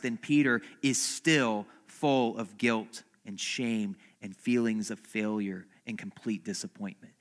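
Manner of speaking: ordinary talk, nothing marked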